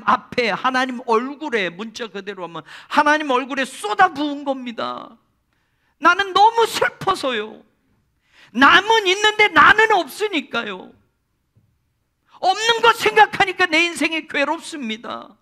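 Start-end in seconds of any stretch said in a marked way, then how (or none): 6.0-15.4 much higher, louder tearful confession; grieving plea. Retold or imitated. Hannah explaining herself to Eli, high tearful plea. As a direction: pour out her sorrow, near wailing. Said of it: "라고 말을 합니다".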